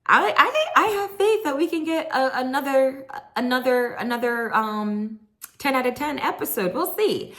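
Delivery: host excited for the next episode; excited hope. Real, not acted. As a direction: gush with hope. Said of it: "excited hope for next episode".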